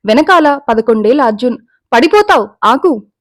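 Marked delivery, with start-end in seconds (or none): 1.9-3.1 put-on loud, higher warning call after a running child. A narrator's reading. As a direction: shout at her to stop before she falls. Boy calls after running girl. (పరుగెత్తే పిల్లని ఆపడానికి గట్టిగా అరుపు)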